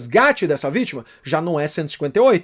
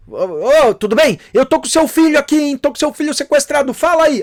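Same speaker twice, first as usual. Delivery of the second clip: higher, louder menacing caller voice; kidnapper on the phone; 6 semitones higher, 4 dB louder than usual. Retold or imitated. Prisoner calling the parent to demand ransom, menacing. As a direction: act the prisoner calling to threaten. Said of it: "Aí ele liga, ó".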